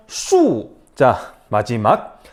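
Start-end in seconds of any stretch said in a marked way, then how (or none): 0.1-0.7 슈 falling sharply from high; fourth tone. Shown as a demonstration. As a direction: drop 슈 fast from the top. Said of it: "사성".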